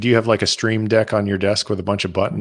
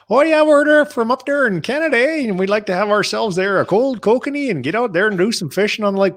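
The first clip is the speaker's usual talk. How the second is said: much higher, louder hammed-up Canadian backwoods voice; mock Canadian accent; 13 semitones higher, 4 dB louder than usual. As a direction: lampoon the Eastern Canadian cliché. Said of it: "People in Western Canada do not talk like that".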